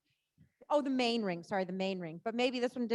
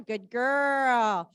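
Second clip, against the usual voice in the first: loud praise to horse; 9 dB louder than usual. Real, not acted. Praising the pony.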